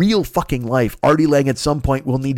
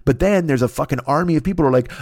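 normal talking voice

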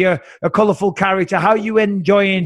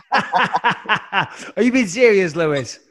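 slow question broken up by laughter; laughing disbelief; 2 semitones higher, much slower than usual. Real, not acted. One host to his co-host who missed the joke, laughing. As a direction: ask it through a laugh, incredulous.

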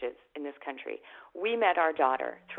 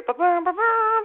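high, slow sung ba-bum fanfare; mock fanfare; 12 semitones higher, 5 dB louder, slower than usual. Imitating the fanfare as the Queen arrives, high and slow. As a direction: hum a royal fanfare slowly and high. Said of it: "and I hear".

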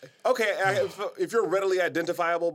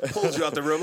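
ordinary talk

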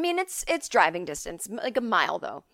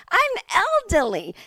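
high, loud mock complaint; aggrieved mom; 11 semitones higher, 5 dB louder than usual. Mom upset by a sniffing dog, aggrieved. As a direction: wail it as a put-upon older mother.

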